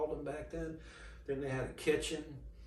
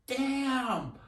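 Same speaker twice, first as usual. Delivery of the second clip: amazed damn; 13 semitones higher, 7 dB louder than usual. Retold or imitated. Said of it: "It was like".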